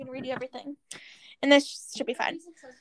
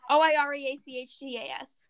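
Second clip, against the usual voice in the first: ordinary talk, nothing marked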